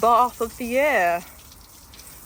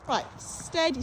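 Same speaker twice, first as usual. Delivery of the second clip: usual speaking voice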